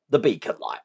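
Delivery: ordinary talk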